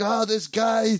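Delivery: high-pitched imitation of tearful gushing; mock crying. Friend teasing how Thad got weepy over a guest, mock crying. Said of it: "starts crying like a little girl".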